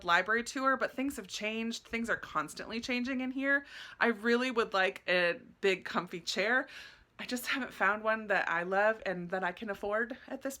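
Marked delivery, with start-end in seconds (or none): none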